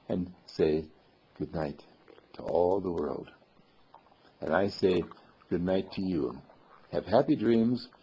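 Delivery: soft, low goodnight voice with pauses; bedtime storyteller. Real, not acted. Storyteller tucks listeners in with teddy bear, soft and low. Soothing bedtime sign-off to children.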